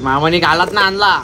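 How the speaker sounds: loud mock kids' whine; kids complaining. Uncle imitates nephews nagging about sweets, loud mock whine. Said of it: "तर नाहीतर पोरा बोलतील".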